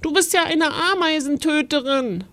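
put-on high, outraged child's protest; indignant accusation. A narrator's reading. Little ghost accusing mother of killing ants, high indignant protest. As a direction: accuse her, pitch held up high.

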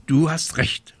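high, cross little witch; angry. A narrator's reading. Little witch snapping back at the raven, angry. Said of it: "rief sie zornig".

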